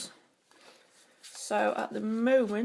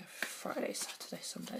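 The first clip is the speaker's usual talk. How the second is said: whispered counting under breath; whispering to herself; 7 dB quieter than usual. Real, not acted. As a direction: whisper the days to yourself. Counting days again to herself.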